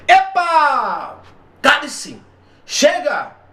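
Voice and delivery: exclamations acted out high and sharp. Shown as a demonstration. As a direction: shout each interjection like a real outburst. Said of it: "Isso é interjeição". (interjeições encenadas, agudas e fortes)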